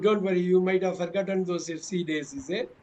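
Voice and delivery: ordinary delivery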